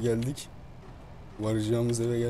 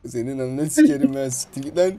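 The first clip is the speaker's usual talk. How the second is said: loud, higher mock-furious cursing; hammed-up furious yelling, louder; angry shout; 5 semitones higher, 13 dB louder than usual. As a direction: yell him away in a hammed-up rage. Cursing at the spooky figure.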